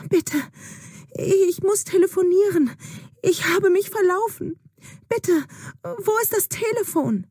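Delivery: high, urgent begging for a phone; frightened girl repeating her plea to the old woman; desperate pleading. Acted. Juna begs the old woman again.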